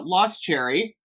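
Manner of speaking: ordinary talk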